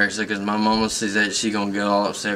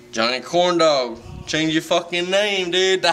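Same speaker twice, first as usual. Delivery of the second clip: excited call-out; 7 semitones higher than usual. Real, not acted. Drunk fan calling out to performer.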